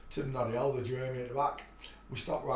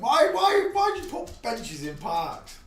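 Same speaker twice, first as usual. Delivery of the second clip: much higher, louder exasperated why; loud high incredulous whining question; mock bafflement; 13 semitones higher, 12 dB louder than usual. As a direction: ham up the puzzled younger self. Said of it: "I used to think".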